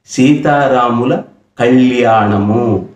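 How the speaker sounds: slow సీతారాముల కళ్యాణము with each syllable clear; careful slow pronunciation. Shown as a demonstration. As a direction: spell out కళ్యాణము slowly. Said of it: "దాన్ని ఎలా పలుకుతున్నాం మనం?". (నెమ్మదిగా స్పష్టమైన ఉచ్చారణ)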